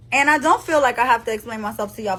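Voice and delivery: ordinary delivery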